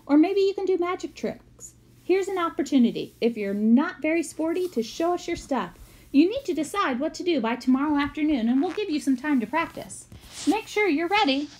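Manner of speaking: a hint of an upbeat teacher selling the idea; mild cheery teacher pitch. Teacher announcing talent show.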